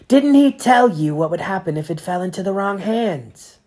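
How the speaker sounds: put-on cold prosecutor grilling a boy; accusing Inquisitor. A narrator's reading. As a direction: pile on the accusations, voice rising.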